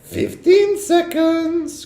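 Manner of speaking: very high, slow, sung countdown; sing-song witch cry. A narrator's reading. Witch counting down at the boy, sung high. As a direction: croon it out like a witch. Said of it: "cried the Grand High Witch".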